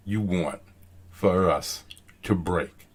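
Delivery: low, soft, with pauses after "want" and "us"; broken-up reading. Shown as a demonstration. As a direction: break "You want for us to break" into slow separate pieces. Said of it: "you have to break it up".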